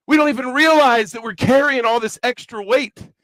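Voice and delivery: ordinary talk, nothing marked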